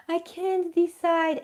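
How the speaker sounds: high, slow mock whine; whiny self-parody. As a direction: drag it out like a helpless collector. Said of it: "I'm making fun of myself".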